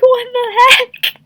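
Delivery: high, laughing what the heck, stretched out; laughing disbelief. Real, not acted. Reacting to the comic kiss, laughing in disbelief. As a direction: squeal it through a laugh.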